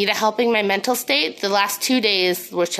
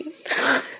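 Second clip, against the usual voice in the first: snorting laugh; 5 semitones higher than usual. Real, not acted. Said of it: "I snort when I laugh".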